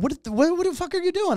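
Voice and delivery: mock indignant demand; indignant producers. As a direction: imitate an exasperated, alarmed confrontation. Producers confront him in retold scene.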